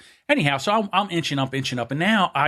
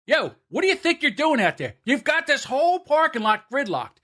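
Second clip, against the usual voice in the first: retelling a rant, voice raised in pitch only; yelling out the window; 10 semitones higher than usual. Retold or imitated. Driver yelling at traffic guards, high mock yell. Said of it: "I yell out the window".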